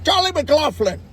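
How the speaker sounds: loud, high mock Irish accent. Shown as a demonstration. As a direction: belt out the name in fake Irish. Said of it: "no one does it with Irish names".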